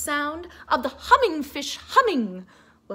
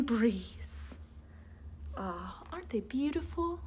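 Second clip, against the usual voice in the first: whispered; 3 semitones lower, 11 dB quieter than usual. A narrator's reading. Whispered ending.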